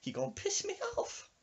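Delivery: very high falsetto warning; mock-indignant squeaky old-woman voice. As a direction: imitate a fed-up old lady up high. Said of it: "She's like".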